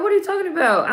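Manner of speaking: high, disbelieving, falling off the end; incredulous mock quote. Viewer voicing the heroine's mock disbelief, pitched high. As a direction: act out her baffled disbelief. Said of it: "She's like".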